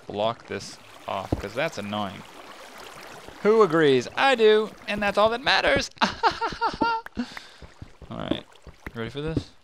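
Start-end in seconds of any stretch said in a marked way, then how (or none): 3.4-5.9 high squeaky put-on voice, louder; silly squeaky voice. Answers own question in silly voice. 6.0-7.4 very high squeaky giggle. Pretend. Giggle after the silly voice.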